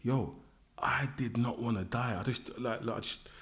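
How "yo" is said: ordinary talk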